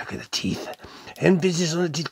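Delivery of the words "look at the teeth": soft whispered aside; hushed whisper. Real, not acted. Host showing viewers the toy's teeth, hushed whisper. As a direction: whisper it, drawing viewers in close.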